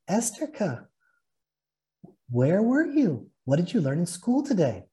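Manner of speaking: high, lilting motherly call; loving mother's greeting. A narrator's reading. Mother greeting her daughter home from school, loving. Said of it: "my mother's usual loving greeting".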